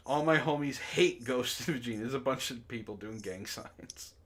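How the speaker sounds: usual speaking voice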